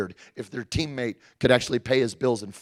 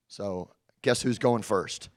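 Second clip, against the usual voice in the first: ordinary delivery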